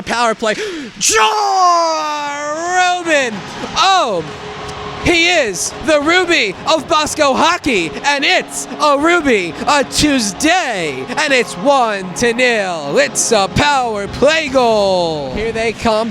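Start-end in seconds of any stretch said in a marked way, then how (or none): 1.0-4.2 high, drawn-out goal shout; long goal yell. Real, not acted. Goal call, long stretched yell. 5.0-15.3 high, slow hype call; slow goal call. Real, not acted.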